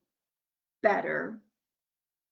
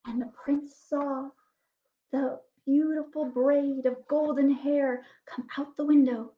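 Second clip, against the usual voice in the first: ordinary delivery, nothing marked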